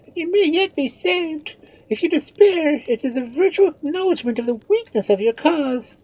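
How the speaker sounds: very high, loud female character voice; impassioned plea to take courage; urging confidante. A narrator's reading. Marianne urges the despairing Queen.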